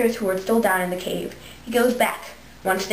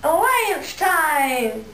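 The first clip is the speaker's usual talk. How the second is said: slow, stretched, higher catchphrase call; show catchphrase; 7 semitones higher, much slower than usual. Acted. Host calling her show's catchphrase to viewers. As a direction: draw out the catchphrase like a jingle.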